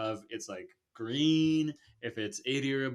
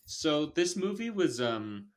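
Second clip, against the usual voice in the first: usual speaking voice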